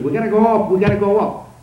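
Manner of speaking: urgent retold shout to go up; retold scream. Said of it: "I started screaming".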